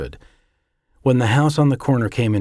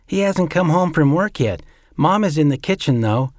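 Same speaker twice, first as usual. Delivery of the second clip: put-on high child's voice, a bit louder; a small boy chatting to his uncle; little boy Jeffrey; 8 semitones higher, 4 dB louder than usual. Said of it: "Jeffrey replied".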